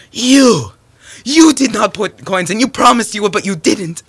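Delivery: high, loud outburst; angry accusation. Acted. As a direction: hurl the blame, high and loud. Spouses accuse each other in story.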